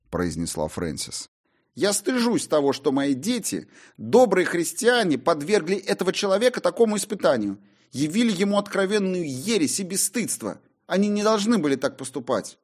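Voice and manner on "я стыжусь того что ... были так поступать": indignant mother. Acted.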